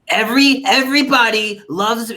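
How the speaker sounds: Donald Trump. Shown as a demonstration. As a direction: lightly mimic a boastful president, stretching the words. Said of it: "you can do Trump".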